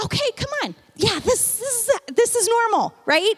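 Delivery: mock reassurance to a new believer; reassuring. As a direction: encourage a toddler gently. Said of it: "We go".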